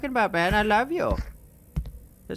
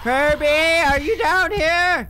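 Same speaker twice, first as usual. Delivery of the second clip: high, loud, drawn-out call; nervous calling out; 9 semitones higher, 6 dB louder, slower than usual. Real, not acted. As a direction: holler for someone downstairs.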